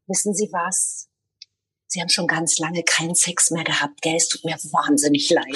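low, unhurried mock sympathy; cool put-down. Driver retelling the comeback to a rude motorist, cool and low. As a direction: deliver the insult coolly, dropping low. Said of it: "ich sehr cool".

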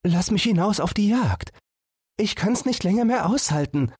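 small animal voice, longing to run out; eager little fawn. Acted. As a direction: beg like a restless young deer. Fawn begs sister.

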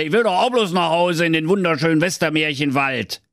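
high, whiny villain voice; angry wizard. Acted. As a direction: grumble and threaten, then whine. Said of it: "wie wütend der mächtige Zauberer".

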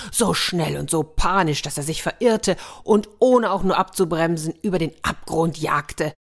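ordinary delivery, nothing marked

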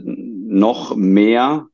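NOCH stretched out with deliberate weight; emphatic NOCH, set apart for weight; stress on NOCH. Real, not acted. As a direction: lean firmly on NOCH before "mehr". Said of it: "in Betonung auf das noch".

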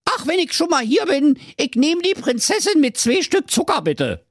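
high, delighted prince voice; delighted cheer. Acted. Prince orders the princess with sugar, jubilant and high. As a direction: squeal it with glee. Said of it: "jauchzte der Prinz entzückt".

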